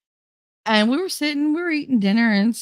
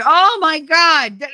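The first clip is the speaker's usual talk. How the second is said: loud, high, drawn-out groaning words; acted exasperation; 10 semitones higher, 8 dB louder, much slower than usual. Child overhearing grandmother fail to hear, acted loud exasperation.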